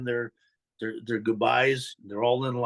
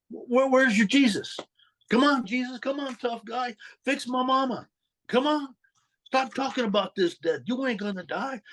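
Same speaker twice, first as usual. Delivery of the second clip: high, strained, taunting cry at Jesus; a son's desperate outburst at his dying mother's bedside; angry challenge to God; 10 semitones higher than usual. Retold or imitated. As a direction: dare God to fix her, voice cracking high. Raging at God over dying mother.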